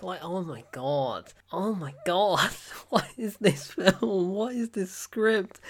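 slow, drawn-out incredulous outburst; exasperated disbelief. Acted. Reviewer reacting to the film's script, exasperated disbelief. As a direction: drag out each question in disbelief.

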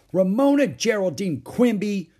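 loud, high, stern reproach; full-name scolding. A narrator's reading. Beezus scolding her little sister, loud and exasperated.

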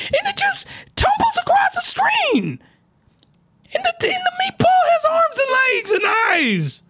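high falsetto shouting in disbelief; shrieking disbelief. Real, not acted. Vlogger ranting about the random meatball, high-pitched shouting. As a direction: screech it out, losing it. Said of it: "I'm sorry".

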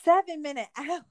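high, loud, sputtering mock meltdown; melting-down hitchhiker. Hitchhiker rejecting six-minute abs, acted-out meltdown. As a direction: imitate a man coming unglued, pitch jumping up. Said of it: "he just seemed like he was melting down".

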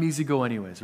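ordinary delivery

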